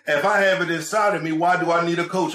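usual speaking voice

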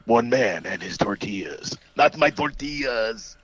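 slow, dramatic trailer narrator; drawn out like an epic trailer; movie trailer voice. Acted. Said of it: "I'm doing nineties trailer guy voice".

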